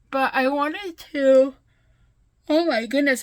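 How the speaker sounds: words stretched through a big yawn. Real, not acted. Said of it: "I am so sorry for yawning again".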